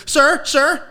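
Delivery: put-on high, loud little-kid call. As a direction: call him like a scared schoolkid. Kids calling out to dad.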